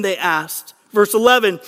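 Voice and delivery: normal talking voice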